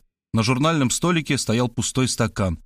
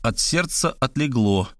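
ordinary delivery

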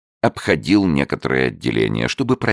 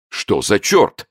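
higher, startled roar; bellow; 8 semitones higher, slower than usual. A narrator's reading. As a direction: roar out in shock. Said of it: "взревел сэр Чарльз".

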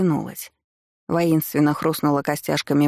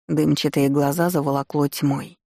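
ordinary delivery

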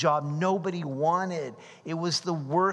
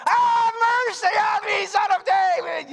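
shouting plea; 5 dB louder than usual. Acted. Blind men shouting.

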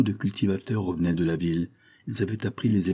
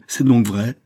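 louder, higher outraged cry; beside herself; 5 semitones higher, 9 dB louder, slower than usual. A narrator's reading. Countess repeats it to her guilty daughter, outraged cry. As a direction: burst out with it, appalled. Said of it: "s'écria la comtesse hors d'elle-même".

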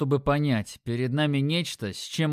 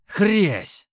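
loud crunch; 6 semitones higher, 6 dB louder than usual. A narrator's reading. Knee-crunch sound effect.

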